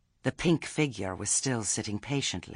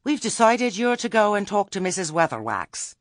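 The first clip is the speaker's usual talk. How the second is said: much higher, louder prim committee voice; officious Lettice; 11 semitones higher, 8 dB louder than usual. A narrator's reading. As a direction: announce the verdict like a self-important chairwoman. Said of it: "said Lettice".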